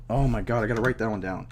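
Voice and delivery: normal talking voice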